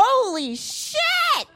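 high, drawn-out amazed host reveal; milk the big reveal like a talk show host; mock shocked announcement. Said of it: "rip open an envelope and go".

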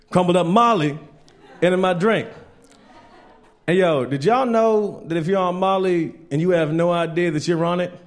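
normal talking voice

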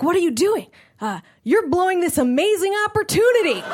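high, pumped-up self pep talk. Retold or imitated. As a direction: hype yourself up.

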